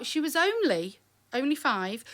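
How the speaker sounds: ordinary talk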